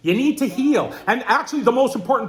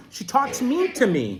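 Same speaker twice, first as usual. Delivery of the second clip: ordinary delivery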